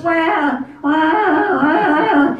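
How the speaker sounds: high sung meows; cat wailing. Retold or imitated. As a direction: wail like a hungry cat. Cat meowing imitated.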